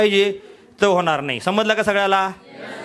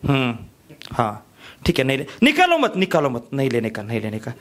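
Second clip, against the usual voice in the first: lower, grudging housewife saying no; reluctant housewife; 8 semitones lower than usual. Retold or imitated. Housewife refusing the hawker, low and grudging. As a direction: brush him off. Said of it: "तो".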